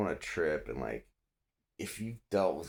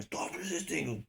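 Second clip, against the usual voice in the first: high, slow strained mock shout; imitating officer's yell; 13 semitones higher than usual. Cop shaking suspect's arms, high imitated yell. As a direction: mimic a cop straining through the order. Officer yelling while kneeing him.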